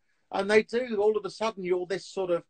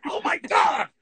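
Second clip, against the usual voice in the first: high, loud exclamation of shock; mock stunned shout; 6 semitones higher, 7 dB louder, slower than usual. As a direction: blurt it out, floored. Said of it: "And he goes".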